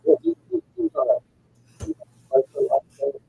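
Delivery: breaking up, garbled audio; scrambled voice. Real, not acted. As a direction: voice cutting out off mic. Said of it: "You're breaking up and garbled".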